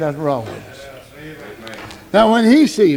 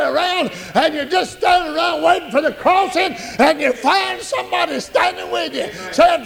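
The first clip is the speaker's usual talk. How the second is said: full-force preaching; 8 semitones higher than usual. Acted. Preacher rousing the congregation about growing old, full-force high preaching. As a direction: preach it high and urgent, stretching the key words.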